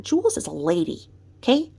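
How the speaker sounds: usual speaking voice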